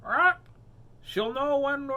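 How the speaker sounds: high squawking parrot voice; high, loud parrot chatter. Acted. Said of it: "squawked Polly Parrot".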